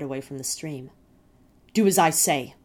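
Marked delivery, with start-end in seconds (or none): 1.7-2.5 loud furious shout; angry mother's shout; shouted order. A narrator's reading. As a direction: yell it down at him. Mother after slapping him.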